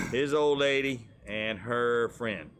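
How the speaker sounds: usual speaking voice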